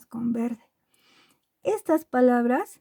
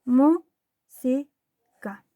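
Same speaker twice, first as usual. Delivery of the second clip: Música as mú, si, ca with long pauses; evenly spaced teacher's Música; split into syllables; much slower than usual. Shown as a demonstration. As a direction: break Música into three slow, separate syllables. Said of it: "Separamos en sílabas".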